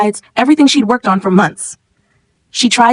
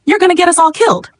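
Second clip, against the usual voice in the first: panicked cry; 11 semitones higher than usual. A narrator's reading. Emma cries at teacher.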